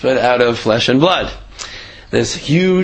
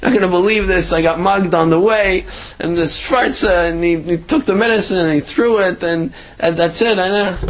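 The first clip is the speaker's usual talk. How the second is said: upset retelling; 7 semitones higher than usual. Retold or imitated. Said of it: "she's crying".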